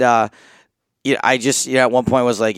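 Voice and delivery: ordinary talk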